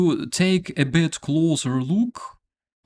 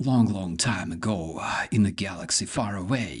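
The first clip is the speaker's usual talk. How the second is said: softer voice-over announcer read; movie trailer narrator; 6 semitones lower, 6 dB quieter, faster than usual. Retold or imitated. Mocking the fake soldier's dub, lightly voiced trailer narrator. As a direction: intone it like a film trailer. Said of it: "They don't go like".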